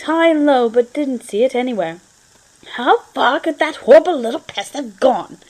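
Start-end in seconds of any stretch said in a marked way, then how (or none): 2.8-5.4 a hint of an exasperated Grell, a bit louder; muttering to himself. Grell searching his flat, lightly voiced irritated question. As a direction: grumble at the missing pest. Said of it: "he asked himself aloud".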